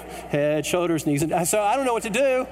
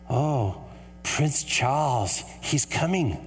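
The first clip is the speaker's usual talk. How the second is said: put-on British accent; 5 semitones lower, much slower than usual. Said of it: "the British lady".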